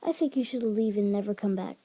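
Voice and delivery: ordinary delivery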